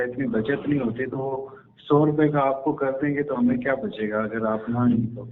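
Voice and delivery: usual speaking voice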